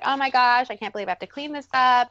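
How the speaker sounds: mock-annoyed mom over a spilled cereal box; mock frustrated. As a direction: huff it out like a fed-up mom. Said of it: "just getting mad and being frustrated".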